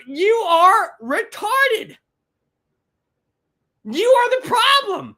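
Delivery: loud, high shouting at the protesters; angry rant. Real, not acted. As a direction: yell them down in real contempt. Ranting at protesters.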